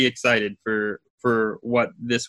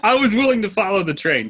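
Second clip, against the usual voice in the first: laughing; 9 semitones higher, faster than usual. Real, not acted. Co-host laughing at joke.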